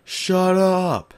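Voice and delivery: high-pitched imitation of an angry dad; yelling dad. Dad telling his screaming son to shut up, high mock yell. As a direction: call out sharply as an irritated dad. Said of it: "My dad was like".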